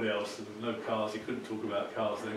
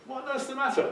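high, anxious mother asking; a worried mum calling to her daughter; mother's voice; 4 dB louder than usual. Acted. Mother finds daughter crying, asks high and alarmed.

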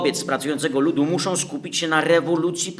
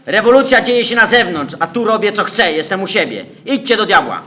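wrzeszcz wysoko i głośno; wściekle przegoń intruzów; furious shout; 7 semitones higher, 10 dB louder than usual. Acted. Lenin wyrzuca intruzów z domu. (wściekły krzyk)

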